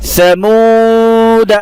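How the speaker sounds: समूद held very long and high; Quranic recitation. Shown as a demonstration. Teacher modelling the word, slow recitation. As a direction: chant समूद with a long final vowel. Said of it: "समूदा पढ़ेंगे".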